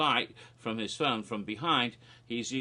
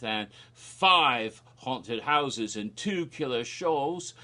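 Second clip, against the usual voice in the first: drawn out slowly, louder; slow promo read; 4 dB louder, much slower than usual. Retold or imitated. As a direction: stretch each word like a trailer promo. Repeating trailer promo text.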